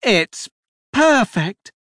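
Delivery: high, loud burst of delight; delighted exclamation. A narrator's reading. Duchess clapping at a cart of clothes, high delighted cry. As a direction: exclaim it, pitch leaping up. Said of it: "the Duchess clapped".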